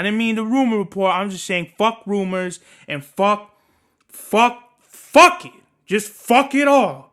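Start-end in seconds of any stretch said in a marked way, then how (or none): 4.1-7.1 much louder and higher blowup; furious shout. Real, not acted. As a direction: let it all explode. Rant peaks.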